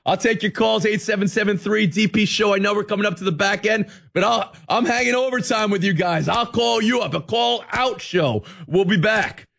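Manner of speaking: high energetic radio host. Acted. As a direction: pitch the call-in line brightly.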